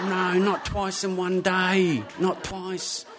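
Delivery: high, slow, drawn-out lament; mock despair. Man asked by police to open bag, mock despair. Acts out dread at bag search.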